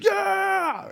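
sky-high shout of yeah; screaming man. Pretend. Hugging friends late at night, a sky-high hype scream. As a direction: scream it like a hyped-up fan. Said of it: "that screaming man moment".

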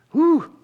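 a high, loud exhale of emotion; emotional whew. Real, not acted. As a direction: blow out the feeling in one high breath. Overwhelmed by the story.

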